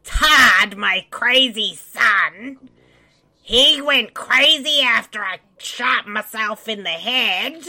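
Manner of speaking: high, slow, stretched-out voice; mother character voice. Pretend. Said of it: "I can't keep up that voice".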